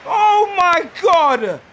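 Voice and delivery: slow, high, drawn-out wail; anguished disbelief. Real, not acted. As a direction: stretch each word out in exasperated despair. Despairing after conceding in game.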